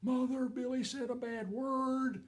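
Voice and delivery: high, slow singsong tattle; mock child's drawn-out tattletale voice; tattling little sister. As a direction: draw it out high, telling on your brother. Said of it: "And she goes".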